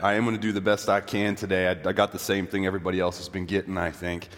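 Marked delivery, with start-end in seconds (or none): none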